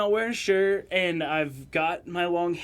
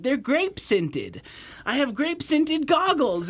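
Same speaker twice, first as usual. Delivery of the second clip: put-on kid voice; 9 semitones higher, slower than usual. Kid voiced in pool story.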